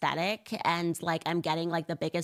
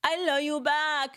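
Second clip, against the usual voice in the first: slow, higher imitation of a Spanish accent; mock Spanish accent; 8 semitones higher, 3 dB louder, slower than usual. Mocking Kamala's Spanish accent, slow and higher. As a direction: copy the clip's Spanish lilt slowly. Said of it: "the other Spanish accent".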